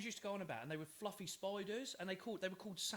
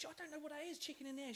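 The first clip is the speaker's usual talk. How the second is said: puzzled; 9 semitones higher, 5 dB quieter, faster than usual. Retold or imitated. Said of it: "I was like".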